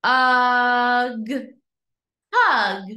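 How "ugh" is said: loud, level, held "Ugh"; stretched vowel sound. Shown as a demonstration. Teacher replaying the sound for pupils to name, stretched vowel. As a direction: hold the vowel of "Ugh" steady before the g. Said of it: "What sound do you hear".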